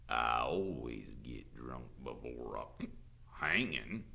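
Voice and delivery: low, slow drawl; drunken sheriff. A narrator's reading. Sheriff admitting his drinking to teacher, lazy drawl. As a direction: drawl it out, untroubled.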